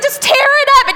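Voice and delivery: high, loud, escalating plea; hammed-up frantic urging, rising to near yell; freaked-out officer imitation. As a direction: imitate a panicking cop begging someone to stop crying. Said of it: "he's, like, totally freaked out".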